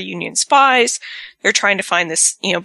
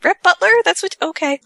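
incredulous mockery; 12 semitones higher than usual. Reacting to Wyatt's Rhett Butler alias, high and incredulous. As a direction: scoff at the cheesy alias. Mocking Wyatt's fake name.

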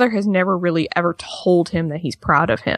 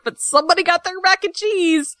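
higher mock-accusing jab; teasing husband; 10 semitones higher than usual. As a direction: poke fun at her snack. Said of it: "it's the way he's like".

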